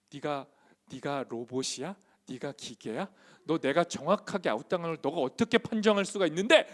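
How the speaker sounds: acting out an indignant schoolboy; retold playground tirade at the umpire; imitated angry outburst. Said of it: "막 화내다가 우는 인간들이".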